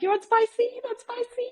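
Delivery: imitating the stall lady. Hawker stall lady pushing spicy food on a foreign customer, high mock voice. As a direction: squeak it out like a pushy aunty at her stall. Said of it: "she's like".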